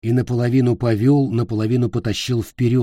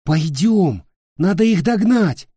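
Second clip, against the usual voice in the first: high, loud urging; urgent urging; 8 semitones higher, 4 dB louder than usual. Acted. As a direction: press him to come along. Nick urges again.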